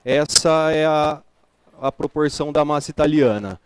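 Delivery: ordinary delivery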